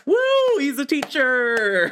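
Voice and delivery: high, drawn-out mock cheer; mock excited whoop. Co-host retelling his reaction to a teaching moment, mock excited whoop. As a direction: stretch it out high, like cheering someone on.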